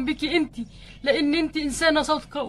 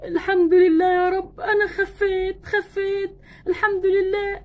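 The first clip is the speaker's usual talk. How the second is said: sobbing thanks, voice higher and breaking; crying with joy; 4 semitones higher than usual. Acted. Ken thanking Barbie for helping him walk, crying with joy. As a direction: weep out your relief as you walk again. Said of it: "امسك نفسك شويه".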